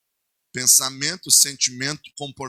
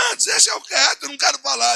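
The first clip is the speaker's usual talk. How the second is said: high, louder mock cry of a wife refusing to talk; imitating wife; 10 semitones higher, 5 dB louder than usual. Wife begging her husband to let it drop, mock crying. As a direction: play the wife pleading, half crying.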